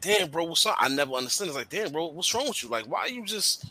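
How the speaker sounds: high, pressing worried questions to a friend; asking a depressed friend what's wrong, with high, urgent concern. Retold or imitated. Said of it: "And it's like".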